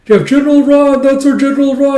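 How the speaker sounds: high, loud excited cheer; hype announcement. Real, not acted. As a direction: cheer his name like a fan. Hyping a character.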